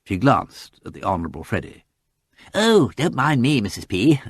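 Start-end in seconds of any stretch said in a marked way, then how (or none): 2.5-4.2 put-on slow, easygoing young toff; breezy, good-natured drawl of a young swell; amiable youth. A narrator's reading. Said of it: "said the youth amiably".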